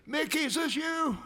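high, loud fake-friendly caller; mock chummy phone voice; con caller. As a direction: mimic a scammer greeting an old buddy. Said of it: "they say".

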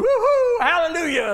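high, loud "woo-hoo" whoop; imagined church cheer. Retold or imitated. Imagining the congregation tithing with a hammed-up joyful whoop. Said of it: "I figured tithing was going".

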